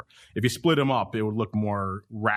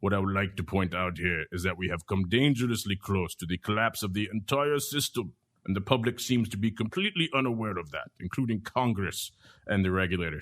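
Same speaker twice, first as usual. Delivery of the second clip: put-on Thomas Petterfy accent; 3 semitones lower than usual. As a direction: impersonate Thomas Petterfy's foreign accent, measured and deliberate. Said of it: "put this in my best, uh, Thomas accent".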